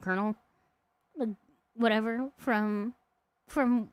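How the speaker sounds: normal talking voice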